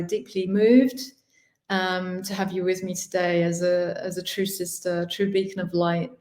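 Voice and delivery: ordinary delivery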